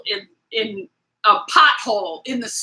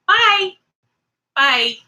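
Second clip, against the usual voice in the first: sassy bye; 9 semitones higher, 4 dB louder than usual. Real, not acted. Dismissing haters on her channel.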